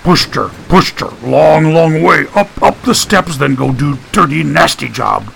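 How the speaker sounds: lightly voiced childlike big man; a hint of an eager simpleton; simple-minded Eustace. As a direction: talk in simple, repeated bursts. Narrator voices simple, huge Eustace.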